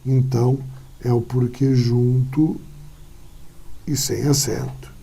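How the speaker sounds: ordinary talk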